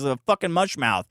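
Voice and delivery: usual speaking voice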